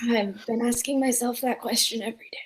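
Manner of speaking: hushed raspy whisper; quiet rasp from a scarred throat. Acted. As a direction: whisper it as a painful admission. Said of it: "voice is kinda raspy".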